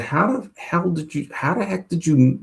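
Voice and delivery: softly amazed, pitch lifted; retold astonishment. Customer to psychic who guessed the name, softly astonished.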